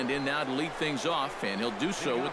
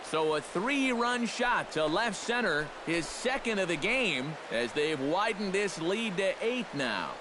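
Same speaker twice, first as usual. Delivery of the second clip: home run play-by-play; 5 semitones higher than usual. Acted. Announcer to fans on a three-run homer, excited play-by-play. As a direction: let the call rise as the ball flies out.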